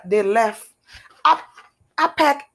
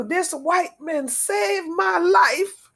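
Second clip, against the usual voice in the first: slow, each word weighed with gratitude; heartfelt and unhurried, a bit higher; slow earnest testimony; 5 semitones higher, much slower than usual. Real, not acted. Testifying to her rescuer.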